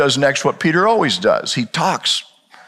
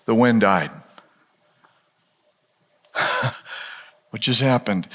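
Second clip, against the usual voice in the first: ordinary talk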